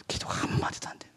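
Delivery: a voiceless whisper of helplessness; hushed whisper. Real, not acted. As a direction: whisper it, drained. Stuck, unable to pray.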